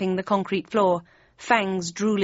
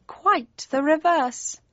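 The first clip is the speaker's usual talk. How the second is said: high, slow cat voice; Cheshire Cat; 7 semitones higher, slower than usual. A narrator's reading. Cheshire Cat correcting the narrator, high and slow. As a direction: draw the answer out high.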